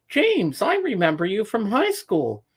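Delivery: high, swooping call of the name; Paul Frenair's surprised recognition. Retold or imitated. Choir director spots an old schoolmate, high surprised greeting.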